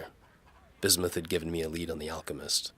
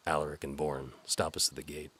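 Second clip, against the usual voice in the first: plain reading voice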